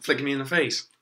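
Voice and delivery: ordinary talk, nothing marked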